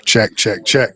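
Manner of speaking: loud, clipped mic check. Real, not acted. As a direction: call it out to test the mic. Sound check before recording.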